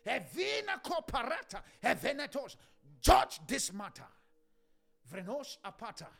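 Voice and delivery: sudden cry then tongues; fervent prayer. Real, not acted. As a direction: burst back into prayer.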